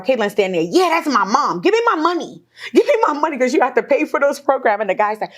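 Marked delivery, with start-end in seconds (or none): 0.8-4.8 raised-pitch imitation of a demanding daughter. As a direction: act out her pushy demand, quicker as it goes. Daughter demanding money, acted out.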